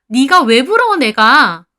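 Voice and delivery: very loud, high-pitched scoff; indignant denial. A narrator's reading. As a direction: deny it with outraged disbelief. Friend scoffs at being called jealous.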